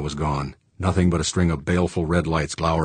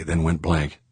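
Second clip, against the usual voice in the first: plain reading voice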